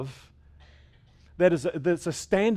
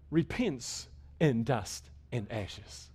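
quiet, slow; 4 semitones lower, 6 dB quieter, much slower than usual. Real, not acted. Echoing Job's repentance, drawn out.

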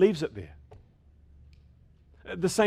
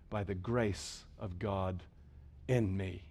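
hushed quote; 8 semitones lower, 10 dB quieter, slower than usual. Retold or imitated. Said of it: "Paul said".

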